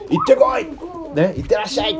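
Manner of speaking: a sending-off shout that eases into 行ってらっしゃい. Retold or imitated. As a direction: send them off with a bright call. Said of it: "送り出すっていうのは".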